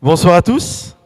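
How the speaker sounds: a booming hello to everyone; big loud hello to the hall; loud greeting. Real, not acted. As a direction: call it out to the whole room, loud. Pastor greets the congregation loudly.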